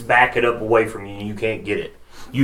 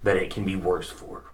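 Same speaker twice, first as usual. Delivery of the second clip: low, quiet brute threat; menacing ogre voice; 3 semitones lower, 8 dB quieter than usual. Acted.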